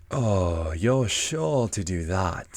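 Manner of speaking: slow, drawn-out cat voice; Cheshire Cat. Acted. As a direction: stretch the words out like a leisurely storybook cat. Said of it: "said the cat".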